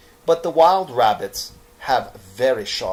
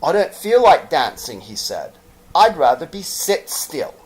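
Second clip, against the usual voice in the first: higher, louder refusal; reluctant little rabbit; 6 semitones higher, 8 dB louder than usual. Acted. Toy rabbit refusing to dance, higher. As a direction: decline stubbornly.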